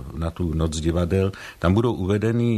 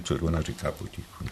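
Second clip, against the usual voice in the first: hushed; 9 dB quieter than usual. Retold or imitated. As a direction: let the voice sink, echoing someone's quiet speech. Playfully echoes her quiet speech.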